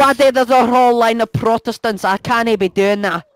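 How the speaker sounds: put-on Irish accent; exaggerated Irish lilt; mock Irish. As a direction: play it in a stage Irish brogue. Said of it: "speaking Irish".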